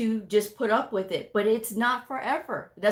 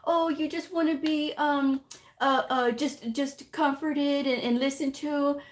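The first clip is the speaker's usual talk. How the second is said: mocking someone who babies others; mock coddling; 6 semitones higher than usual. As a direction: coo at them like a coddling parent. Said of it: "They coddle people".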